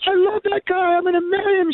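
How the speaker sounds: very high mock swooning voice; lovestruck woman. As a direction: call out like a smitten admirer from a balcony. Plays lovestruck woman on the veranda.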